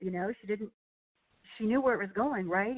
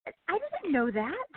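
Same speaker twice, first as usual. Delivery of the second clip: mock innocent; 9 semitones higher than usual. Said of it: "I'm like".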